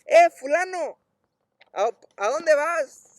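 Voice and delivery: high, loud holler to a passer-by; shouted greeting, pitched well up; calling out. Retold or imitated. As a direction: hail someone across the street, drawn out. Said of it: "la hermana le grita".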